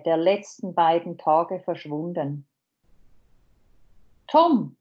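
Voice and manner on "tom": higher, louder call of a name; urgent call across the room. A narrator's reading. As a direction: call to him to wake him. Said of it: "rief ich".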